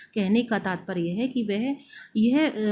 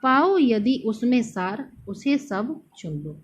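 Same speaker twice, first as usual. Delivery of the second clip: slow, measured verse recital; poem recital; 2 semitones higher, slower than usual. Shown as a demonstration. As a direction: let the verse line land slowly. Recites the next quoted verse. (धीमा काव्य पाठ)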